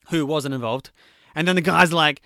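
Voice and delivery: ordinary talk, nothing marked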